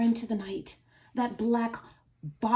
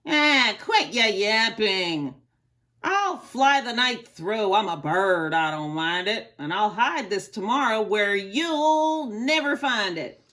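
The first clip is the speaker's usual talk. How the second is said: gruff eagle; 7 dB louder than usual. Acted. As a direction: brush off the elephant. The eagle calls back.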